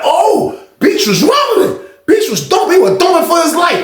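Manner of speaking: high, shouting, amazed commentary; imitated excited onlookers. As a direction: hype the fight like a spectator. Onlookers marvelling at fight.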